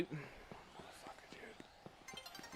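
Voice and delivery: hushed whisper cursing; whispered curse. Real, not acted. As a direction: hiss the insult under the breath. Muttering curse at Pokemon.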